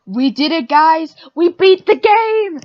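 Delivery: loud triumphant shout, slowing at the end; excited cheer. Real, not acted. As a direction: celebrate the win out loud. Streamer cheers at beating game.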